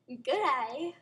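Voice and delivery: loud, badly faked Australian greeting; hammed-up bad Aussie accent; awful Australian accent. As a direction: belt out a terrible Australian accent. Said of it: "awful Australian accent".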